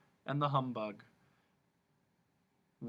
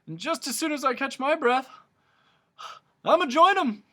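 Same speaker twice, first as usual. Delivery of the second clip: breathless humbug; 13 semitones higher, 9 dB louder than usual. A narrator's reading.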